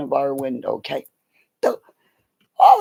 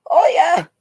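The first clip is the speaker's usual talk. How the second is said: excited; 9 dB louder than usual. Real, not acted. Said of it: "second, bigger oh yeah".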